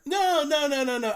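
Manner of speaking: high, insistent no's; emphatic denial. Real, not acted. Answering the host's question about being jaded, protesting. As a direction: wave off the idea.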